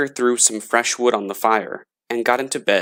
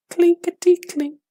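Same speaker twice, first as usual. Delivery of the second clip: very high, tinny clinking voice; coins rattling. A narrator's reading. Coins rattling in the gravedigger's tin box, high clinking voice. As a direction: ring it out like tiny silver coins. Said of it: "it went".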